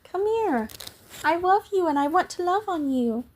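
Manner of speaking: high cooing pet voice. Real, not acted. Streamer calling her cat over in high cooing baby talk. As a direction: coo up high like talking to a kitten.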